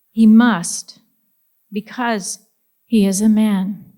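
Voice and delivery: deliberate poetry recital with punched openings; poem reading. Acted. Poet reading Thoughts of a Man to an audience, emphatic measured recital. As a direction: declaim each phrase, pausing between lines. Reads her second poem.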